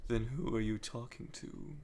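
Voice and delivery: a quiet, menacing phantom's tease; sinister Starline. Retold or imitated. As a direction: purr it like a cool, taunting phantom. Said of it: "And Starline says".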